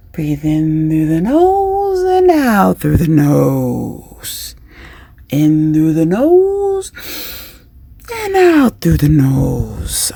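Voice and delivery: slow, drawn-out breathing cue; guided breathing. Shown as a demonstration. Coach leading a breath in and out, slowly. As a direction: stretch the words with each breath.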